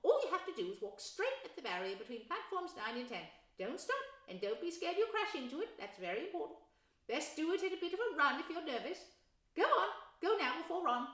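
high, loud, kindly mum; instructing mother. Acted. Mother telling Harry how to run at the barrier. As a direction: coach him through it gently.

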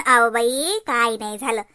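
low, gruff-toned husband voice. Acted. Daughter voicing the husband calming the aunt, low. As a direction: drop down to play the husband.

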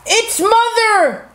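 high, stretched joyful call; excited cry. A narrator's reading. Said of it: "they cried".

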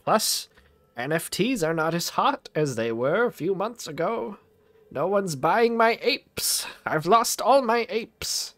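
high, slow, drawn-out cartoon complaint; mock-lamenting shopkeeper. Scrooge bemoans his sorry business to a visitor, hammed-up lament. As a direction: bemoan your losses in a high put-on voice.

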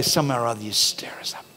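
hushed plea to the Spirit; whispered prayer. Real, not acted. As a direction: pray it in a whisper.

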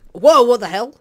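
real high, loud shock; startled yell. Real, not acted. Player startled by something speedy in game, startled yell. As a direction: jolt into a sudden shout.

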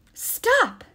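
high, loud cry to stop; shouted halt. Acted. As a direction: shout to halt them from afar. Breathless satyr yells to stop.

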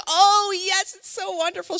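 excited grandma. Retold or imitated. Grandmother delighted to be asked to feed the baby. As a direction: gush like a thrilled grandmother. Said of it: "She'd be all excited".

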